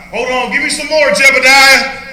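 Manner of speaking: high, loud, slow mocking dare; taunting call. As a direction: drag out the name to goad the enemy. Taunting enemy soldier in acted battle.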